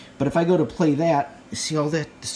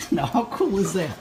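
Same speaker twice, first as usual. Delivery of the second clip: laughing; 6 semitones higher than usual. Real, not acted.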